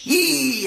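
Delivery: high drawn-out groan of a near miss; crowd groan. Retold or imitated. Mimicking football fans at a near miss, high groan. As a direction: cry out like fans when the ball hits the bar. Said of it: "এরকম কিন্তু শব্দ হয়".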